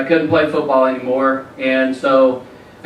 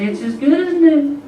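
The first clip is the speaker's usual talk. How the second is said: high, reassuring mom imitation; sweet Southern mom; 10 semitones higher than usual. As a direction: chirp it as a precious Southern mother. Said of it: "and she said".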